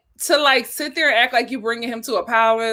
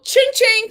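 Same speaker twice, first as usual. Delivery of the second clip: ching ching; 10 semitones higher than usual. Pretend. Money sound for super chat.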